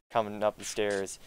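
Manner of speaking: ordinary talk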